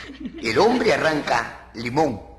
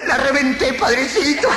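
high, hammed-up confession; mock-fervent high cry; comic outburst; 10 semitones higher, 4 dB louder than usual. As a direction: blurt it out to the priest, pitched high. Penitent's gleeful confession punchline.

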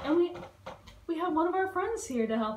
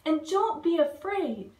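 higher, a touch louder encouragement; called out; 7 semitones higher, 4 dB louder than usual. Acted. Dilly encouraging scared bugs, called out. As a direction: reassure them while calling out. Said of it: "cried, called Dilly".

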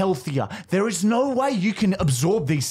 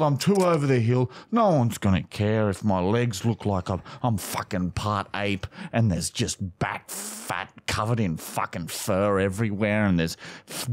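low, defeated self-disgust; mock self-loathing; 7 semitones lower than usual. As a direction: wallow in mock shame, voice sunk low. Acted self-loathing speech before ad pitch.

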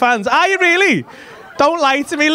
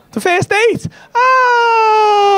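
surprised shout; 10 semitones higher, much slower than usual. Real, not acted. Comedian shouting in surprise at a first date. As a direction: yelp it out in shock. Comedian reacts to crowd answer.

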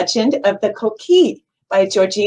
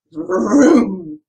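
imitated engine rev, drawn out; motorcycle vroom. Librarian telling of a ride with dad, mock motorcycle vroom.

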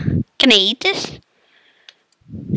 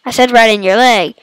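ordinary talk, nothing marked

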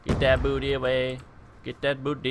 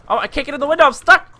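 much higher, loud yell; frustrated yell; 12 dB louder, faster than usual. Real, not acted. Gamer stuck in a window, yelling high and loud. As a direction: shout it, stuck and desperate.

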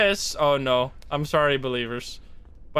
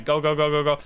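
normal talking voice

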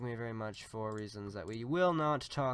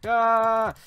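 loud high scream; short, piercing yell of alarm; startled scream; 10 semitones higher, 17 dB louder than usual. Real, not acted. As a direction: scream out in sudden panic. Attacked in the game.

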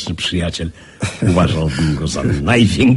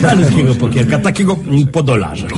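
high, louder, eager wheedling; eager coaxing; 7 semitones higher, 4 dB louder than usual. Acted. Bored gentleman coaxing friends into poker, eagerly. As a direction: tempt them into a little game.

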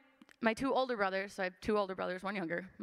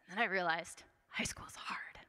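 softer, whispered confession; whispered aside; 3 semitones lower, 4 dB quieter than usual. Real, not acted. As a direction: let it slip out as a hushed admission. Rueful aside.

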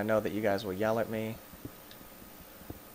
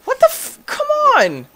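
very high, loud shriek; outraged scream; 13 dB louder than usual. Real, not acted. Gamer hit by the creature, outraged scream. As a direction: scream in exasperated disbelief. Attacked again.

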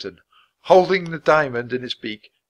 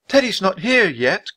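put-on croaking girl; 7 semitones higher, 3 dB louder than usual. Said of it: "croaked Annie".

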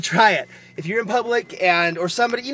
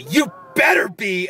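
slow shouted threat, louder; daring menacing shout; 4 semitones higher, 4 dB louder, slower than usual. Shown as a demonstration. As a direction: bark it slow like a real warning. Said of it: "a more daring thing".

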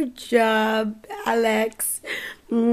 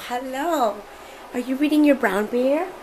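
ordinary delivery

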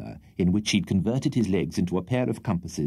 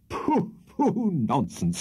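dismissive; 7 semitones higher, much slower than usual. Acted. Wardle dismissing excuse.

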